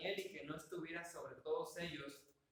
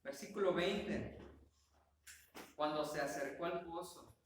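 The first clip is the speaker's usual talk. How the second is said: ordinary talk, nothing marked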